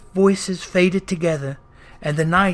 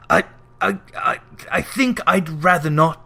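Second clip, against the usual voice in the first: halting, frightened stammer; a hint of a scared old man backing away; stammering refusal. Scrooge timidly refusing the three spirits, stammering in fear.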